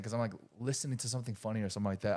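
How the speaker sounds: usual speaking voice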